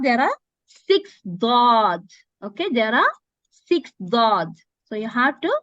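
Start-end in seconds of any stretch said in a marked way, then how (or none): 0.0-2.0 six dad said slowly and loudly; stretched count. Shown as a demonstration. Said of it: "What's the meaning of six haraka?".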